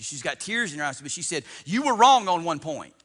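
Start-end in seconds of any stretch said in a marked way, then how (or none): 1.6-2.9 high, loud correction; retold confrontation, raised voice; emphatic challenge. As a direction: hit it hard as a sudden objection. Acts out the lady's challenge.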